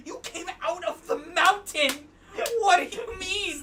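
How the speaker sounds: high, loud cry of disbelief; incredulous shout. Real, not acted. Player protesting at a sudden game event, incredulous. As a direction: yell in baffled protest.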